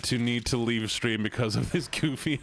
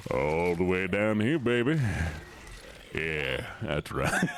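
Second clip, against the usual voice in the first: deep voice; 5 semitones lower than usual. Pretend. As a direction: keep it down low and smug. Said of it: "talk like this from now on".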